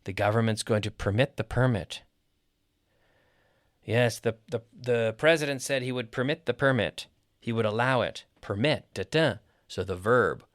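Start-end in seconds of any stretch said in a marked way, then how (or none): 0.9-2.0 first PERMIT per-MIT, second PER-mit; contrasting syllable stress. Shown as a demonstration. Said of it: "verb gets the stress on the second". 6.1-7.1 first PERMIT per-MIT, second PER-mit; contrasting syllable stress. Shown as a demonstration. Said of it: "verb gets the stress on the second". 8.4-9.3 PERMIT as per-MIT, echoed da-DA; per-MIT, then da-da in the same rhythm; stress on second syllable. Shown as a demonstration. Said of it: "stress on the second half".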